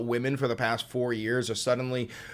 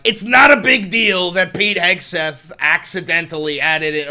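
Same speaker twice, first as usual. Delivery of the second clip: loud, put-on pundit voice, waving it off; mocking pundit voice; 5 semitones higher, 11 dB louder than usual. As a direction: play down a scandal in a loud mock voice. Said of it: "they're like".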